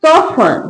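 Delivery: falling model soften, T left out; silent T in soften. Shown as a demonstration. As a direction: soften said as "soff-un", no T. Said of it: "टी का sound नहीं आएगा".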